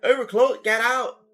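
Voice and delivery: high, loud mock-bitchy yell. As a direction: play a rude night-shift worker kicking customers out. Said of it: "people are usually real bitchy".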